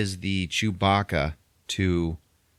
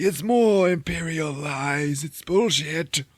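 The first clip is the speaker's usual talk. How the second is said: very slow, much higher mock Saw voice; slow, high parody of Forrest Whitaker; 9 semitones higher, slower than usual. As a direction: stretch every word like the strained old rebel. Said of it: "that's what fucking For-, Forrest Whitaker sounds like".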